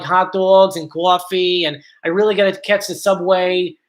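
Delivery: heavy New York accent. Shown as a demonstration. Streamer demonstrating how New York talks, heavy New York accent. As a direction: lay on New York vowels thickly. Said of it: "New York talks more like".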